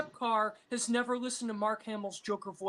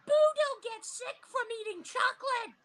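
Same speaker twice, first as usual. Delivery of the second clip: put-on much higher childish anime villain; Majin Buu voice; 4 dB quieter than usual.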